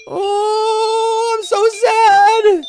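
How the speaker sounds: very high, wailing mock sob through the quotes; mock crying voice. Streamer mocking a rival's sad Discord post, wailing high. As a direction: ham up a crybaby reading his own sad posts.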